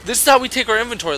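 ordinary talk, nothing marked

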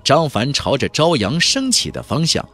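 ordinary delivery, nothing marked

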